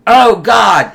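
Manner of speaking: loud, high mock cry of anguish; world-shattered despair. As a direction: cry out as if everything collapsed. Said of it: "His world's shattered".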